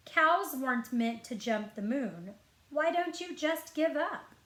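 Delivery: ordinary delivery, nothing marked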